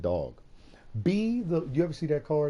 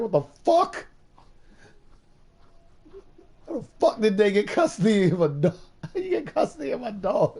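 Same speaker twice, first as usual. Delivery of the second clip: loud, high, indignant disbelief; mock outrage; 10 semitones higher, 7 dB louder than usual. Dumped partner yelling about losing the dog, outraged and loud. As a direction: yell it like your dog was stolen. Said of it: "you'd be like".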